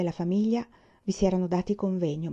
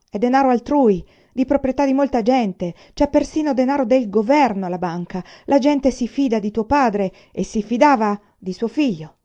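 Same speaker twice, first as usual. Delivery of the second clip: loud, stern ringing voice; severe rebuke; 5 semitones higher, 8 dB louder than usual. A narrator's reading. Grandfather severely reproaching the grandson who stole, loud stern voice. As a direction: lay down the law. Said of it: "La voce del vecchio echeggiava severa".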